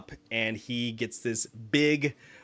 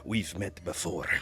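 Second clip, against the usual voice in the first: Kraus's voice; 5 semitones lower, 5 dB quieter than usual. Acted. Dragonborn Kraus replies coolly.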